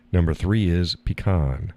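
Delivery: "pecan" as pee-KAHN; "pecan" with long ee and broad ah; "pecan" pee-KAHN, falling; pronunciation demo. Shown as a demonstration. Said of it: "How do you pronounce it".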